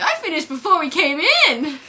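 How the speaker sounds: put-on high, loud little-kid voice; sing-song child's reply; Ralph. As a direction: chirp it like a small boy. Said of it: "And Ralph's like".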